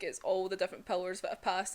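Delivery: ordinary talk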